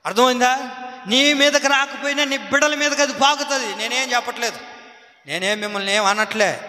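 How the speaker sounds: ordinary delivery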